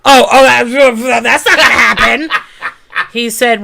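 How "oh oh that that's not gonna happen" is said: laughing, loud, drawn-out put-on voice of the ex; mock whiny ex. Woman mocking her ex telling the judge, loud and laughing. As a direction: ham up his lame excuse to the judge.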